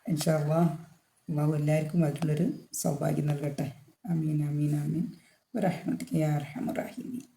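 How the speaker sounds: soft, subdued supplication; murmured prayer. Real, not acted. Teacher praying for everyone's good fortune, softly murmured prayer. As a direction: let the prayer drop low in volume.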